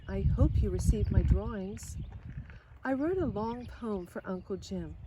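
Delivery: ordinary delivery, nothing marked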